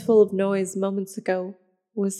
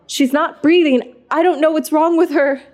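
panicked; 7 semitones higher, 5 dB louder than usual. Acted. Panic over sister's body.

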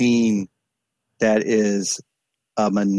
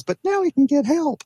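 retelling mom in a much higher voice; mom's hopeful reply. Retold or imitated. Mother defends her son to dad, in a much higher imitated voice. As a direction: voice mom's relief, pitched way up. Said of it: "and she goes".

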